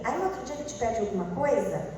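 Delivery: normal talking voice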